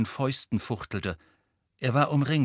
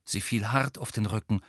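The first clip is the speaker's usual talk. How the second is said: ordinary delivery, nothing marked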